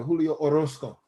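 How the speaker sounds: "Orozco" with a rolled Spanish R; R rolled mid-word. Shown as a demonstration. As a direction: roll the R inside "Orozco". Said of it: "for my R to roll".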